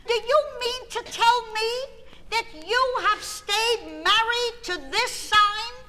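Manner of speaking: astrologer. Retold or imitated. Astrologer telling the wife about her husband's chart, grandly shocked. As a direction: proclaim it like a shocked oracle. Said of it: "she said".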